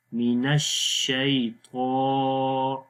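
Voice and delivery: slow drawn-out tajweed of الشیطان; Quran recitation demo. Shown as a demonstration. Teacher demonstrating slow tajweed to students. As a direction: recite من الشیطان slowly with elongation. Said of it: "نر باریک پڑھیں گے".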